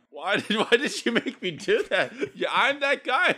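high, loud laughing protest. Real, not acted. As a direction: laugh through the words. Laughing at himself.

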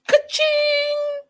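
high mock cash register cry; squealed money ka-ching. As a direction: ring it out like a till. Cash register sound effect.

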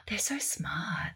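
slow whispered amazement; awed whisper. Retold or imitated. Marvelling at bilingual little kids, slow awed whisper. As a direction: breathe it out in a slow hush. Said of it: "and I'm like".

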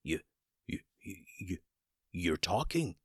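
halting, stuttered repeats, falling quiet; stammering in shock. Acted. Shocked wizard stammers at his talking chicken. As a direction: trip over the words in amazement. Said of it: "stammered Dooley".

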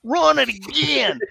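high, slow, mocking coach's bark; yelling coach. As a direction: drag it out like a coach riding his player. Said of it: "Phil Bennett would be like".

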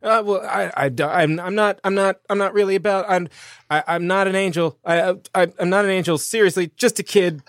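high, stammering protest; flustered Jonnet denying it. Acted. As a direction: trip over the words to correct them. Jonnet nervously denies being angel.

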